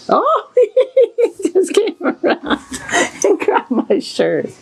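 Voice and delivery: high giggly laughing through words. Real, not acted. Horse grabs her shirt.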